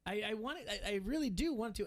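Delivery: high, whining mock complaint, then settling down; mock whiny protest. To co-host Merrick, a high mock whine of protest. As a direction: play the sulky kid who got told no.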